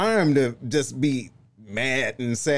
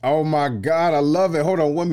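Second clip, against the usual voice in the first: louder, higher burst of delight; excited exclamation; 6 semitones higher, 5 dB louder than usual. Real, not acted. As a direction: jump in thrilled. Host excited by her answer.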